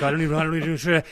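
half-comic flustered panic, voice pushed up; retold freaking out. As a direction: play back a mild freak-out, stumbling over the words. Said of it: "I'm kinda freaking".

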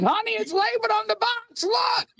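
high, shouted complaint; exasperated shout. Retold or imitated. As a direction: yell it at your wife in disbelief. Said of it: "He was like".

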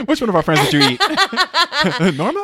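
high, loud, laughing joke question; laughing tease. Real, not acted. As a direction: crack up while teasing her. Joking she ate friends.

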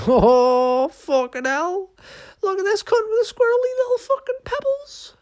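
very high, loud, cackling mock voice; a second monkey shrieking with scorn at the squirrel; jeering monkey man. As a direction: squeal the insult like a cartoon monkey.

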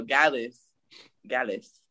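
"Gallus" as GAL-us, two syllables; right pronunciation. Shown as a demonstration. Confirming it for co-host, "Gallus" pronounced GAL-us. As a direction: say "Gallus" starting like gal, falling at the end. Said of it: "you're saying it right though".

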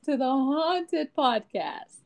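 slow, high spooky host voice; slow theatrical villain menace. Acted. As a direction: drag it out like a haunted house host. Spooky Halloween podcast intro.

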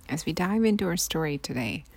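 ordinary delivery, nothing marked

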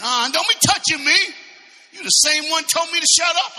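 high, loud hammed-up protest; mock indignation. Preacher acting blind man rebuffing his silencers, mock indignant. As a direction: push them off, acting offended.

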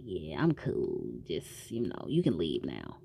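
low mumbled blow-off to get rid of her; imitating a low, quiet dismissal; dismissive brush-off. Jack brushing off the doctor.

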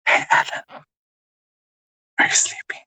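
unvoiced bedtime whisper; hushed ASMR role-play. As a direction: whisper as if lulling someone to sleep. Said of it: "I want you to A S R me".

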